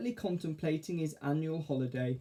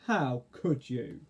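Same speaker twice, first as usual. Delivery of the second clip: very slow, hurt caterpillar; slow reproach; much slower than usual. Acted. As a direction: drag it out, wounded. Arthur character.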